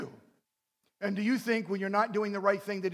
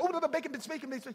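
excited dog voice; 8 semitones higher, faster than usual. Retold or imitated. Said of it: "the little dog going after".

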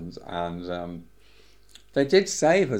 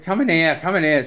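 urgent call; 7 semitones higher, 7 dB louder than usual. Retold or imitated. Gus retold, urging him upstairs.

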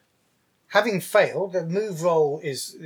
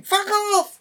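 high-pitched, drawn-out shout. Acted. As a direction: shriek it as the fleeing woman. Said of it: "But she's shouting".

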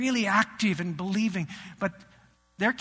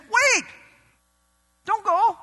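urgent plea; 12 semitones higher, 5 dB louder than usual. Retold or imitated. Jesus invited to stay.

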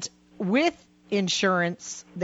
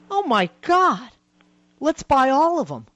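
higher, louder retold gush of excitement; excited girlfriend; 6 semitones higher, 4 dB louder than usual. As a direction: play the girlfriend thrilled by the bargain. And she goes.

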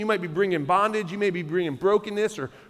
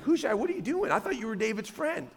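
baffled onlooker, pitched up and quieter; puzzled questioning; 3 semitones higher, 6 dB quieter than usual. Acted. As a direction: question a friend in lightly acted disbelief. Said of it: "you may look at this and be like".

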